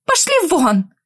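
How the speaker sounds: sharp yell at a pest. Acted. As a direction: shout it out, fed up. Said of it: "заорала я". (крик)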